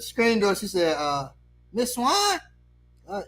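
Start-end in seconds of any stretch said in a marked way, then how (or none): 1.7-3.2 high-pitched impersonated neighbour woman; comic high-voiced neighbour; wary neighbour. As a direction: answer the door in a high, hesitant voice. Neighbour answers at screen door.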